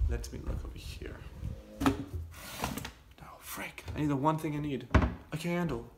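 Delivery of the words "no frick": frustrated whisper under the breath; whispered curse. Real, not acted. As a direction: hiss it to yourself, annoyed. Can't find a candle.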